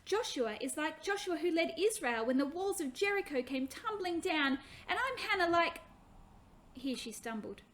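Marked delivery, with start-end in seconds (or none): 0.0-5.8 lightly voiced eager girl, higher and louder; a child proudly showing off what she knows; eager little girl. Hannah eagerly explains names.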